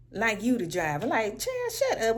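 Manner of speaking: normal talking voice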